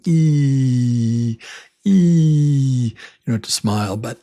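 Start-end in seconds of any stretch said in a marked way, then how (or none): 0.1-2.9 E held long with spread lips, louder; smiling long E. Shown as a demonstration. Teacher demonstrates the long E sound. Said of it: "Like I'm smiling".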